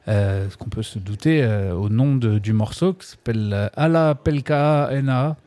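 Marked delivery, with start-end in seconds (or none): 3.5-5.4 "Ala Pelka Ena" tried in a foreign Finnish way, slightly higher; attempted Finnish. Real, not acted. Radio host stumbling through a Finnish song title. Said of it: "désolé pour mon accent".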